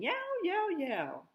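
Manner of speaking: high yelping dog noise; dog yelling. A narrator's reading. Narrator imitating the dog's yelping howl. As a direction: yelp it out like a stray dog. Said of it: "and yelling".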